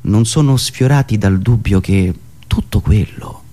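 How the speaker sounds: ordinary talk, nothing marked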